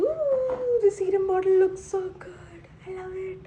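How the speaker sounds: thrilled, high gushing; delighted squeal. Real, not acted. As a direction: squeal over the pretty bottle. Delighted by serum bottle.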